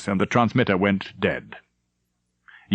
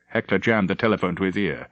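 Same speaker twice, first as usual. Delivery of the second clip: ordinary delivery, nothing marked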